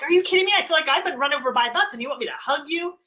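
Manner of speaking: exasperated outburst. Retold or imitated. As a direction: blurt it out as a drained, overwhelmed parent. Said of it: "You're like".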